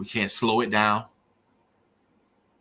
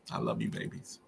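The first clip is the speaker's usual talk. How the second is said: a real, soft whisper of love; tender whisper; 12 dB quieter than usual. Real, not acted. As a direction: breathe it to them as a last goodbye. Farewell to his dead children.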